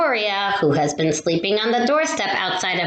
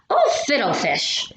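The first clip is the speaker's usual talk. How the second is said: mild mock holler; 3 semitones higher than usual. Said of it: "she hollers".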